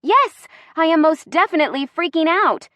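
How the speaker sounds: high-pitched exasperated outburst; emphatic, worked-up reply; freaking out. Acted. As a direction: burst out indignantly. Admits she is freaking out.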